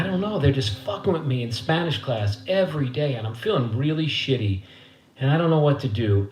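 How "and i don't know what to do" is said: usual speaking voice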